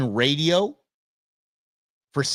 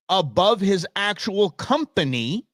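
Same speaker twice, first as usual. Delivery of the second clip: ordinary talk, nothing marked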